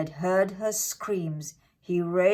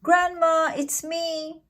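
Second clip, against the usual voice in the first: put-on higher, child voice; little girl calling; 10 semitones higher than usual. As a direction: call sweetly into the cottage.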